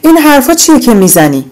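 high, loud protest at her mother; furious daughter shouting back. A narrator's reading. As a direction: yell it out, stop her mother cold. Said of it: "فریاد زد".

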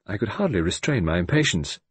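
ordinary delivery, nothing marked

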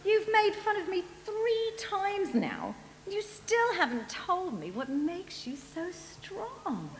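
high, soft, sulky plea; pouting. Acted. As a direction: pout and guilt-trip him in a small high voice. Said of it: "Then Delilah pouted".

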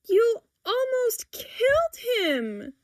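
high, drawn-out horrified cry; distressed cry. A narrator's reading. Daughter stopping mother from stepping on cat, crying out. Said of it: "she cries".

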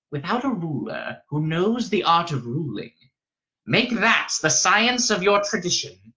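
stern, high-pitched elder; old Reverend Mother, solemn. A narrator's reading. Old woman concludes her lesson.

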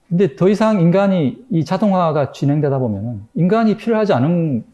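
ordinary talk, nothing marked

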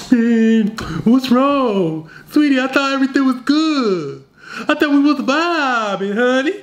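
put-on high, pleading needy boyfriend voice; Beta Billy. Boyfriend panicking at girlfriend pulling away, high needy voice. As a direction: whine it up high like a clingy simp. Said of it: "Are you gonna turn into Beta Billy?".

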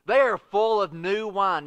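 a light sneer on the quote, slowed. A narrator's reading. Preacher reading the crowd's taunt, mild sneer. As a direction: read the mockers' line with a mild sneer. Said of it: "some sneered and said".

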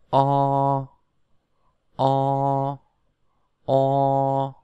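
long, level O with rounded mouth. Shown as a demonstration. Teacher shows how O is read, rounded and drawn out. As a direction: round the mouth and hold O steadily. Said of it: "Chúng ta bắt đầu đọc nào".

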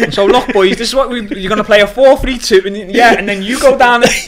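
loud, high coaching voice; head coach. Retold or imitated. Coaching the hospital team on tactics, loud commanding coach. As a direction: boss the players like a head coach.

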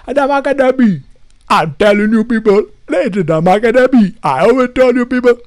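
put-on Jamaican accent. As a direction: lampoon a YouTuber's Jamaican accent. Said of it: "Jamaican, accent".

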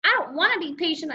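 fast, high outburst; boy protesting to mom, upset; cried out. A narrator's reading. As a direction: blurt it out in frustration. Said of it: "I cried".

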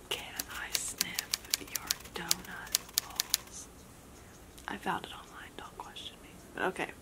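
secret, drawn-out whisper. Real, not acted. Player tells viewers the secret phrase while partner listens to music, whispering. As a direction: let the camera in on it in a hushed whisper.

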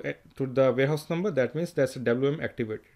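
ordinary talk

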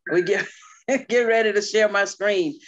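excited and a little laughing; excited call. Real, not acted. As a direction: announce herself with a burst. Calling out eagerly to group.

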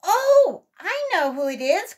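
high, loud exclamation; excited cry. A narrator's reading. Sammy recognises the callers, crying out. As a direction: cry out as you realise who is there. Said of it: "cried Sammy".

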